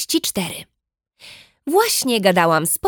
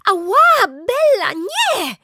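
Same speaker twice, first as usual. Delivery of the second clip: put-on high, pained yelp at the baby; high yelping protest; pained cry; 13 semitones higher, much slower than usual. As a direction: cry out in pain, pleading. Bitten by baby sister, crying out.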